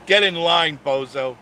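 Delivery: loud teasing jab, get in line; mock taunt. Taunting a hater in chat, loud mock jab. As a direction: shout it back at the haters, grinning.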